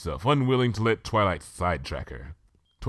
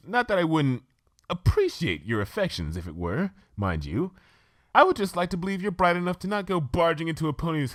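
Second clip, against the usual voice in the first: much higher, posh-sounding unicorn lady, chatty; Rarity, refined lady; 9 semitones higher than usual. Acted. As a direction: play the prim fashion pony gushing about tea. Rarity voiced by reader.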